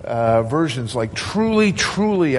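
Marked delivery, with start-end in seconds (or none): 1.1-2.4 "Truly, truly" pitched high, second one stepping down; emphatic. Retold or imitated. Preacher quotes another translation of Jesus's words, emphatic. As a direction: hit each "truly" as a solemn emphasis. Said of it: "There's an emphasis here".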